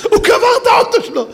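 high, loud, laughing delivery; laughing shout. Real, not acted. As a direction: shout the punchline through laughter. Laughing at the punchline. (צעקה צוחקת, גבוהה ורועשת)

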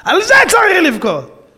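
preacher crying out the moral; emphatic cry. Acted. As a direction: hammer the line home. Repeated with force.

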